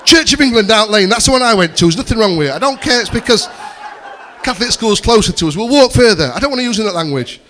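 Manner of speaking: high, loud mock squabble; parental argument. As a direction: argue back as an exasperated parent. Acted parental argument about schools.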